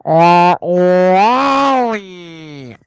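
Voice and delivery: robot voice impression, name drawn way out; long, loud, gliding robotic cry; Wall-E. Shown as a demonstration. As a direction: stretch the name in a swooping robot call. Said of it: "my latest best voice impression".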